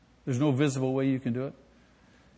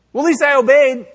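high, loud whiny protest; mock excuse; 10 semitones higher, 11 dB louder than usual. Excuse-maker defending a late obedience, high and loud mock. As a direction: protest like a caught sinner.

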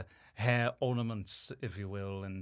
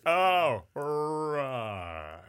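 Kronk impression; 8 dB louder, much slower than usual. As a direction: play Kronk, dim and delighted. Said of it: "he's Kronk".

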